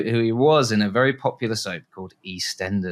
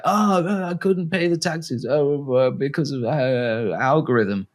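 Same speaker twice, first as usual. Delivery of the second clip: whiny excuse voice; 5 semitones higher, slower than usual. Retold or imitated. Tax dodger voiced in a pitch.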